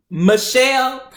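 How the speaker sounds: loud, high, drawn-out name; pointed confrontation. Retold or imitated. Patient calling out the nurse by name, pointed and loud. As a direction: stretch the name out as a challenge.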